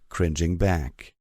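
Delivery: usual speaking voice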